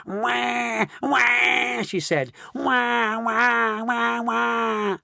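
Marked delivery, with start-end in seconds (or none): 0.1-1.8 put-on high, drawn-out baby bawl; imitated baby crying, high-pitched; bawling baby wail. A narrator's reading. Josephine turned baby in bed, bawling. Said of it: "bawling her head off". 2.5-5.0 put-on high, continuous baby bawling; imitated infant wailing, on and on. A narrator's reading. Josephine turned baby in bed, bawling. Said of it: "bawling her head off".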